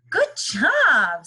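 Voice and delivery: bright, loud praise; cheerful praise. Acted. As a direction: say it like a proud friend. Rob praising the robot.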